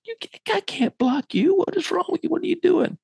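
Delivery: very high-pitched incredulous scolding; mock exasperation, voice way up without shouting; exasperated coach. Coach scolding a player who won't tackle, high and exasperated.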